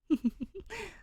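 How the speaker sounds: high, scraping ghostly laugh; grating metal laugh. Acted. The city ghost laughing in Danny's head, a grating metallic laugh. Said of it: "laughter like grating metal in her head".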